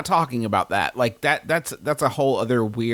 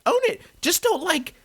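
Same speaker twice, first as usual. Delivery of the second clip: much higher, insistent urging; emphatic exhortation; 10 semitones higher than usual. Real, not acted. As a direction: push it up high and urge them hard.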